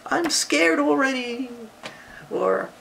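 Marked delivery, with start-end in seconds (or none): 0.1-1.5 very high pretend-scared cry; scared exclamation. Shown as a demonstration. Example of exclaiming for a child, acted scared. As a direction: exclaim it as if frightened. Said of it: "someone's exclaiming something like".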